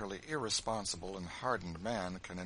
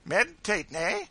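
high, loud jester's jibe; facetious teasing; 12 semitones higher, 11 dB louder than usual. A narrator's reading. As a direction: poke fun loudly, pitched way up. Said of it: "poking him facetiously in the side".

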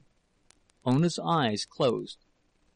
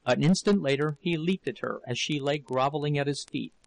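usual speaking voice

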